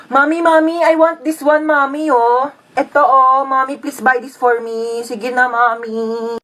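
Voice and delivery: whiny toy-shop plea; pleading child. Acted. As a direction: wheedle like a kid, drawing out the last words. Child begs mother for toy.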